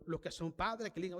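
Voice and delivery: ordinary talk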